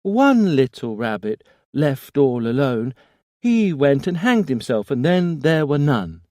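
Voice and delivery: put-on high, loud eerie childlike rhyme; a ghostly child chanting, full force; little boy voice. A narrator's reading. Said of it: "The voice seemed to grow stronger".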